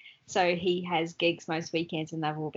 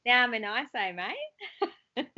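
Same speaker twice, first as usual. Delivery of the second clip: hammed-up Australian accent; 5 semitones higher, slower than usual. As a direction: toss it off like a cheeky Aussie. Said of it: "repeating the Aussie line".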